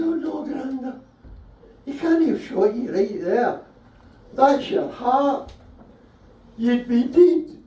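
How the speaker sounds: put-on breathless child begging for the war story; eager kid voice. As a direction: squeak it out like an excited wee kid. Comic voicing young self to granda.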